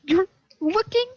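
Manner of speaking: a very high, tiny squeak of disbelief. Acted. Frightened girl squeaks at adventurers hunting a dragon. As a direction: squeak it out like a startled mouse. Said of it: "And she squeaks".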